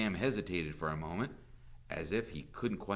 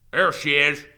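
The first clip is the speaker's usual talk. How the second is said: loud, high holler of accusation; angry mob leader's shout; 7 semitones higher, 15 dB louder than usual. A narrator's reading. Mob leader storming the schoolhouse, shouting. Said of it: "Trout shouted".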